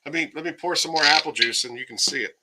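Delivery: normal talking voice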